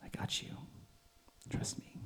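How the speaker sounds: hushed, gentle whisper of reassurance; quiet voice. Retold or imitated. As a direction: breathe it like a still small voice. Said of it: "the quiet voice that says".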